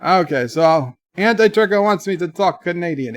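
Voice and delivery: normal talking voice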